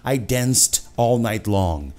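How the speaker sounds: slow, word by word; each word spelled out slowly; overcareful slow demo; slow over-careful. Shown as a demonstration. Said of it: "você não vai falar".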